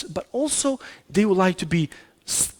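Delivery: ordinary talk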